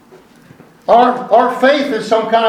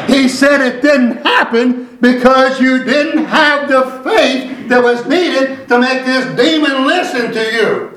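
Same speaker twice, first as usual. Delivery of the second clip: slow, deliberate pulpit delivery; measured preacher cadence with weighty stresses; emphatic preaching; 6 semitones higher, slower than usual. Real, not acted. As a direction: drive each phrase home to the congregation. Preaches to the congregation.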